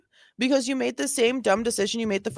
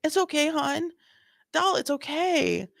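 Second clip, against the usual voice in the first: mocking sweet cop; 5 semitones higher than usual. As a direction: coo at her like a sweet officer. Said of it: "he's being so nice".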